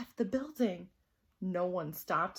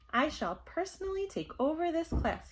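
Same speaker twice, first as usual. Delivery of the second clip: higher, prim principal voice; pompous principal; 7 semitones higher than usual. Acted.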